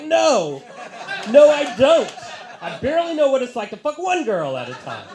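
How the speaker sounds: high, loud comic protest; exasperated shout. Retold or imitated. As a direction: yell it in wounded exasperation. Exasperated answer to boastful friend.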